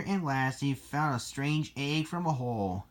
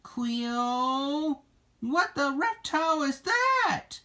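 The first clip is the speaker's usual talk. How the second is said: high-pitched, alarmed exclamation; shocked outburst; 13 semitones higher, slower than usual. Acted. Ryan demands an answer from Cleo, high shocked outburst.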